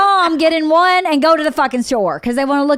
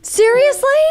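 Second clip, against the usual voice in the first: incredulous; 10 semitones higher than usual. Retold or imitated.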